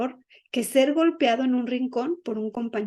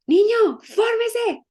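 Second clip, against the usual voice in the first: put-on high, loud barked order at a child; director's shout; 11 semitones higher, 6 dB louder, slower than usual. School director yelling at a small boy to line up, loud high shout. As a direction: yell the command across the schoolyard. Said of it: "el director empezó a gritar".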